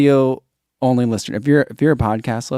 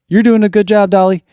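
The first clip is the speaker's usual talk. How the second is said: dog praise; 6 semitones higher, 6 dB louder than usual. Pretend.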